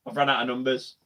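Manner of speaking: quiet self-mutter, leaning on "run". Real, not acted. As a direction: mutter it to yourself as an aside.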